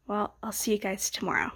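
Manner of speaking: normal talking voice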